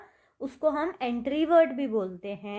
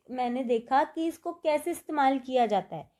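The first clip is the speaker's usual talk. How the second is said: usual speaking voice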